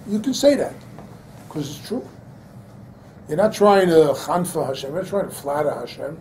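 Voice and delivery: normal talking voice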